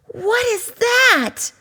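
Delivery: a cub frightened by a roar; startled high cry; startled little cub. Acted. Ice crashes, cub startled.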